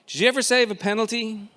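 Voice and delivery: put-on high, quick, eager boy's question; a small boy pestering his dad at bedtime; eager child. Excited child asking dad quickly.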